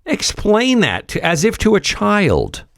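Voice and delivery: high, incredulous repetition; exasperated repeat. Real, not acted. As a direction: jump up high in exasperation, falling at the end.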